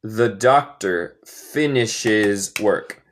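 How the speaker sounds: slow, careful repeat; slow teacher demo; slow model sentence. Shown as a demonstration. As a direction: say it slowly for students to copy. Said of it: "teacher repeats model sentence".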